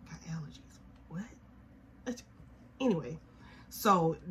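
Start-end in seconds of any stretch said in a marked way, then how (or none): 0.1-1.2 breathy whispered aside. Real, not acted. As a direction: drop to a whisper.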